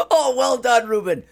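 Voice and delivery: high, bright cheering; excited cheer. Real, not acted. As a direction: cheer him on loudly. Cheering friend eating cricket.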